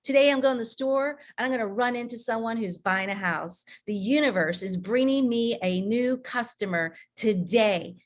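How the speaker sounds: usual speaking voice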